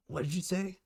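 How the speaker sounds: sharp threatening question; menacing father. Acted. Father warning daughter, menacing. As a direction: dare her to repeat it.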